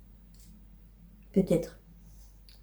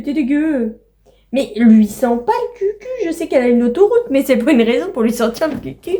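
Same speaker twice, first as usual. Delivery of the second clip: higher playful scolding of the pets, louder; pet talk; 4 semitones higher, 4 dB louder than usual. Real, not acted. Owner teases pets for sniffing each other's bums, playful scold. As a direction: tease the animals like naughty babies.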